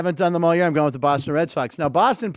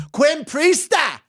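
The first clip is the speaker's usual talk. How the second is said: hammed-up Boston guy calling out; Boston accent; 8 semitones higher, slower than usual. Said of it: "a more New England name".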